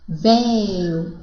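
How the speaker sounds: "véu, clarabóia", slow, open stressed vowels; "véu, clarabóia", drawn-out open-vowel demonstration; open diphthongs. Shown as a demonstration. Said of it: "formados por ditongo aberto".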